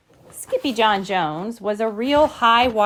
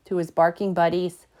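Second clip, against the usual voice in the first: usual speaking voice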